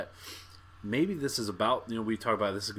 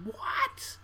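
high, squealed WHAT of disbelief; mock yell pitched way up, without extra volume; retold yell. Podcaster retelling his outburst in the theater, high mock yell. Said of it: "but I just yelled".

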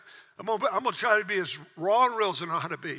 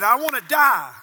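loud despairing quote; 2 semitones higher, 9 dB louder than usual. Retold or imitated. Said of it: "And he said".

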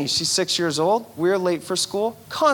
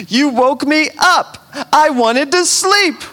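put-on whiny child; 9 semitones higher, 8 dB louder than usual. Dad and child quarrel voiced.

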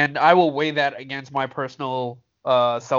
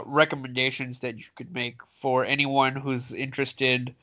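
usual speaking voice

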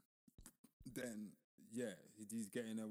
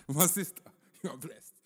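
louder, smug reassuring preacher; mock pastor; 3 semitones higher, 10 dB louder than usual. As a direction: soothe her like a pastor.

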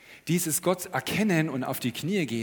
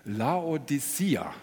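slow, drawn-out Laodicea; very slow slimy snail voice; much slower than usual. Shown as a demonstration. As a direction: stretch every syllable of Laodicea. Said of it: "jetzt muss ich ganz langsam sprechen".

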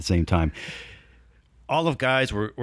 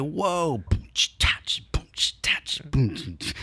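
whispered beatbox; 5 semitones higher, slower than usual. Retold or imitated. Imitating his dance moves.

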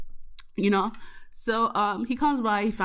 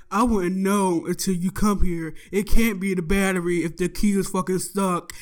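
imitating a dismissive mechanic; being a dick; 4 dB louder than usual. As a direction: put on a mildly condescending voice. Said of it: "being, like, such a dick about it".

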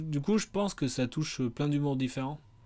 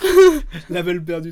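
laughing; 3 semitones higher, 11 dB louder than usual. Real, not acted. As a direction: laugh breaking out high. Laughs while speaking.

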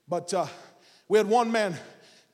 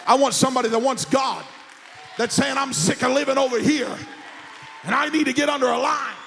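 raised, impassioned pulpit cry; forceful preaching; 3 semitones higher than usual. Real, not acted. Preacher calls for people who want God, forceful preaching. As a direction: preach it out to the congregation.

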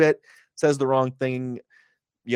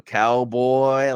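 drawn-out cowboy; 4 dB louder than usual. Shown as a demonstration. Host showing how Mox mocks the moniker, dragging out Cowboy. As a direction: stretch Cowboy out long and mocking, the word Cowboy held. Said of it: "really drags it out".

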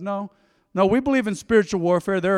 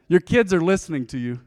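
ordinary talk

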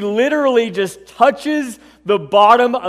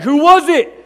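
raised, higher call into the crowd; 7 semitones higher, 6 dB louder than usual. Acted. Jesus calling out to the crowd, loud and high. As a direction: demand the one who touched him to speak up. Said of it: "he calls out".

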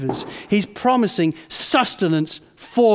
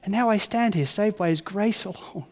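ordinary talk

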